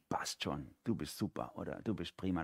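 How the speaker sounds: low, soft self-talk; quiet self-reassurance. Acted. Talking to yourself in the mirror, quiet self-reassurance.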